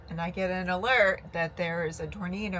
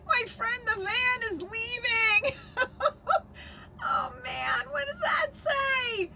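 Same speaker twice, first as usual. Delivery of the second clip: tearful panic. Real, not acted. Van leaving during tornado warning.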